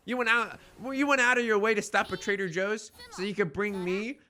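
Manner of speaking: ordinary delivery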